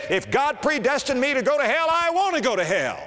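quoted preacher. Retold or imitated. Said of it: "One preacher said".

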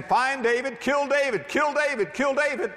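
high-pitched, driving repeated commands; urgent mob cry. Acted. Everybody calling for David's death, frenzied high chant. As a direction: hammer out the orders high and insistent.

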